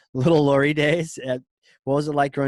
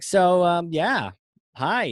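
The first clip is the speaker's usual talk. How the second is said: high, unsure greeting; awkward nervous host; 7 semitones higher, 4 dB louder, much slower than usual. Retold or imitated. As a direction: fumble into a shy hello. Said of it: "I was like".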